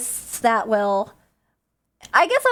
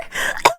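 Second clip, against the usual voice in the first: short choking cough. Real, not acted. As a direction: clear the windpipe with a cough. Choking on water on stream.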